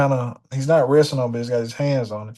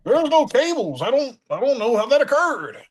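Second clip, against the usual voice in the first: high Jerry voice; 10 semitones higher than usual. Retold or imitated. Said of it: "He goes".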